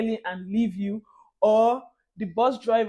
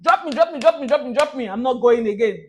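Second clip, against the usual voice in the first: higher, louder repeated "drop me"; calling the driver; 3 semitones higher, 7 dB louder than usual. Retold or imitated. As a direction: call out to the bus driver to stop. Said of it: "Unlike in Nigeria, you could say".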